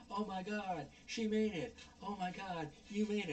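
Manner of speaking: high, gushing imitation; mock excitement. Retelling characters dawdling by the helicopter, mock excitement. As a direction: put on over-delighted, unhurried amazement. Voicing relieved characters chatting away.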